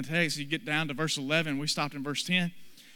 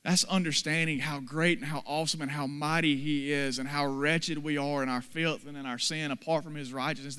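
usual speaking voice

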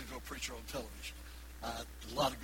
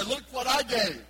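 loud, higher, slow show-off; boastful mock; 10 semitones higher, 10 dB louder, slower than usual. Mocking a proud giver, loud boastful. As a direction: brag like a showy giver. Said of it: "stand up and say".

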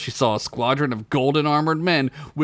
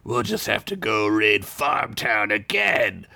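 gravelly knight; 2 semitones lower than usual. Acted. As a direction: menace them calmly. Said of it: "in a gravelly voice".

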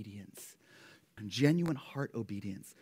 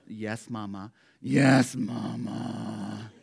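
grudging; 3 semitones lower, 6 dB louder, much slower than usual. Retold or imitated. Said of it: "expression of displeasure".

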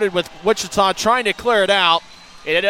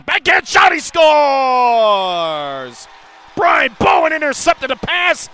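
goal call shout; 9 semitones higher, 9 dB louder, slower than usual. Real, not acted. Goal call in hockey broadcast.

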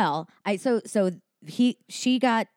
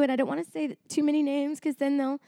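usual speaking voice

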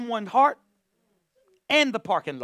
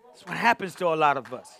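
usual speaking voice

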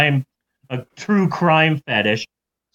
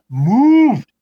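moved punched louder and higher; emphatic moved; 6 semitones higher, 5 dB louder than usual. Acted. Teller stresses how moved the author was, moved punched loud. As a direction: hit moved hard and high. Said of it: "I tell ya".

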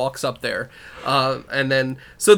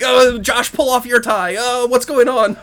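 frantic scramble; 9 semitones higher than usual. Retold or imitated.

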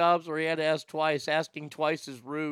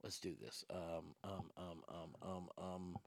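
far softer, low setup muttering; off-mic mutter; 8 semitones lower, 8 dB quieter than usual. Real, not acted. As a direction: talk under your breath off mic.

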